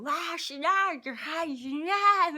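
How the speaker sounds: put-on loud, high, slurring drunken screech; drunk woman screaming. Drunk woman at his table causing a ruckus, imitated screaming. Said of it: "screaming and kinda like that".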